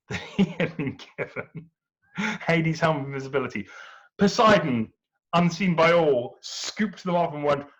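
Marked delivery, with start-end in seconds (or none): none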